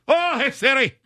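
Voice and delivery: put-on high-pitched, botched Irish accent; badly faked accent. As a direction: call out high in a hammed-up wrong accent. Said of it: "it's wrong accent".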